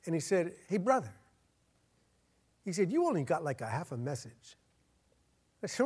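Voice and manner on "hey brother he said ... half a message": squeaky little voice. Retold or imitated. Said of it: "had this squeaky little voice".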